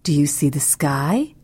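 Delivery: plain reading voice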